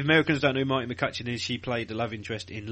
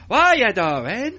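loud, high, drawn-out mock accent; Brian; 9 semitones higher, 8 dB louder, much slower than usual. Podcaster mimicking another performer, slow high mock accent. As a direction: stretch it out in a muddled put-on accent. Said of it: "Brian was like this".

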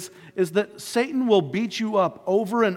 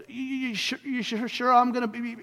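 higher, halting stutter as Moses; acted stammer, starting softly and higher; 3 semitones higher, 3 dB quieter than usual. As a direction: trip over the first words, repeating them nervously. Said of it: "he's, like, stuttering".

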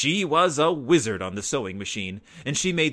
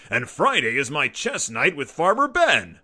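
complaining; 3 semitones higher, 5 dB louder than usual. A narrator's reading. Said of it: "complained Papa".

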